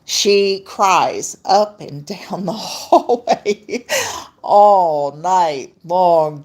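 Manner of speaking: slow and laughing, drawn out; amused, giggling slowly. Real, not acted. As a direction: laugh through it, stretching the words. Laughing about the cat crying.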